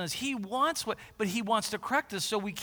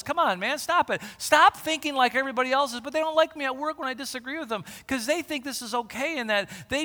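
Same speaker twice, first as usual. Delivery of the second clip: higher, louder urgent plea; God pleading; 5 semitones higher, 6 dB louder than usual. Acted. Preacher voices God urging his people to turn, higher and urgent. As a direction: plead like a loving father. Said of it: "God's saying".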